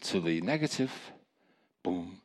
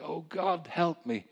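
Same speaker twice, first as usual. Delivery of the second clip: raised, pleading retold prayer; a mild cry from the heart, pitched up; patient's cry for help; 9 semitones higher, slower than usual. Preacher quoting a patient crying to God, higher and pleading. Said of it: "that patient who just said".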